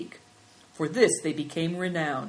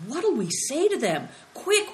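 plain reading voice

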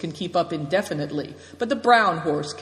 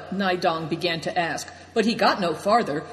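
plain reading voice

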